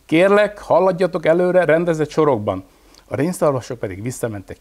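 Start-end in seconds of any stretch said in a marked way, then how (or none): 0.0-2.6 high, loud megaphone call; police pup addressing the reindeer by loudspeaker; through a loudspeaker. A narrator's reading. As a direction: announce it over a loudspeaker. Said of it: "mondta a hangszórójába".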